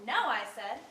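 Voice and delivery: loud, defiant outburst. Acted. Cast-off lover refusing to leave, defiant outburst. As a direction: refuse him point-blank, very loud. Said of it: "Don't take that tone with me".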